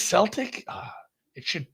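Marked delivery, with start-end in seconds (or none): 0.0-0.6 Celtic as "SELL-tick", pitched up in question; soft C in Celtic. Shown as a demonstration. Checking the answer with co-host, Celtic with soft S. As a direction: query Celtic with an S sound. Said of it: "It is Celtic?".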